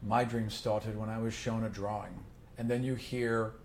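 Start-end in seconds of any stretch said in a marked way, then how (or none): 0.1-2.1 low, soft-spoken sad king; quiet, low voice of a stoic ruler touched by sadness; God King. Acted. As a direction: speak softly, deep in your range, solemnly. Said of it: "he looks at it".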